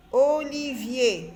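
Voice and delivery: "Olivier" slow, each syllable held; drawn-out syllables. Shown as a demonstration. Teacher checking the word with children, "Olivier" slowly sounded out. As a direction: stretch out every syllable of "Olivier".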